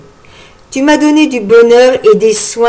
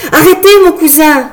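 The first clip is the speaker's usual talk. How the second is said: louder and higher, pleading cry; high urgent cry to stop him; 7 semitones higher, 4 dB louder than usual. Acted. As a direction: call out to stop his arm. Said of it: "s'écria Marguerite".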